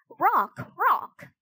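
high, repeated raven croaks. A narrator's reading. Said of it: "croaked the raven".

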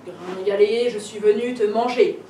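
loud, snarling big bad wolf; growling wolf. A narrator's reading. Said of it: "le loup grogne et postillonne". (loup qui grogne fort)

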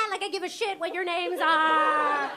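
high, drawn-out mock sneer; mocking retort. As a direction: throw it back at the kids, slowly. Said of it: "I'm like".

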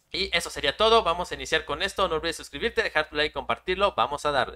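high, loud sign-off pitch; hyped YouTuber outro. Acted. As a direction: pump up the viewers before the run.

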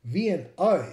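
put-on English driver's accent, exclaimed; imitated English driver. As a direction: mimic an English cabbie saying V and A as "vee-an-eye". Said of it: "and he said".

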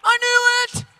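I knew it, hollered with glee; triumphant yell. Retold or imitated. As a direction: crow it in triumph. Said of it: "And I'm going".

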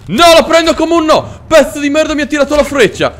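high, loud shout of outrage at being shot; outraged yell. Real, not acted. Player shot by a villager's arrow, outraged high yell. Shot by an archer mid-greeting.

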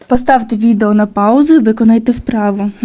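usual speaking voice

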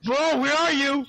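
high, loud urgent call; yelling across the room. Real, not acted. Searching during fight.

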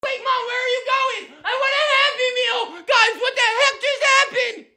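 high-pitched whiny shouting; indignant high kid wail; panicked protest. Acted. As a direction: cry out like a kid left behind. Child left out as mom leaves.

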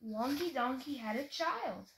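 ordinary delivery

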